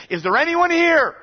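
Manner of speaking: high, loud courtroom call; calling out in court. Retold or imitated. Court official calling for Paul's defender, loud shout.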